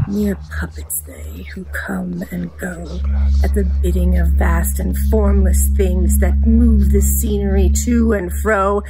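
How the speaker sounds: ordinary delivery, nothing marked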